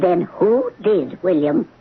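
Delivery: slow, low, pointed question; stern, drawn-out challenge; accusing. Acted. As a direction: press the suspect for a name. Client accuses broker of theft.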